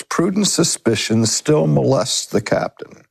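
ordinary delivery

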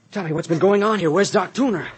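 loud, high, alarmed questions; urgent demand. Acted. Man urgently demands what happened.

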